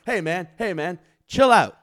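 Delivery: loud mic callout, slowing at the end; imitating Peanut shouting to calm a moshing guy; calling out over mic. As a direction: tell him off over the PA. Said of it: "Peanut got on the mic and was like".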